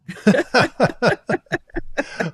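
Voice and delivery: short laugh at herself; embarrassed laugh. Real, not acted. Laughs at own accent.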